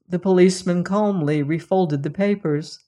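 plain reading voice

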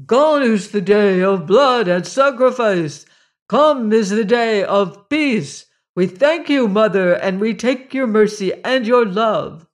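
loud, high shouted acclaim; crowd shouting. Acted. The multitude shouting thanks to the mother, loud and high. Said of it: "and shouted".

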